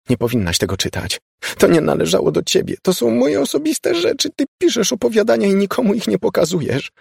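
high upset voice; a hint of a tearful girl. A narrator's reading. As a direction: defend yourself, hurt and flustered. Laura upset, defending herself.

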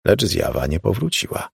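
usual speaking voice

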